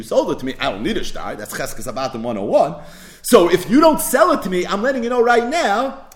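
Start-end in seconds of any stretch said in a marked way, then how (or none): 3.2-6.1 rising higher and louder into a mock warning; retold threat. Lender warning the borrower he'll keep the field, mock threat. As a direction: lean in like a landlord who won't budge. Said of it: "See, he threatened him".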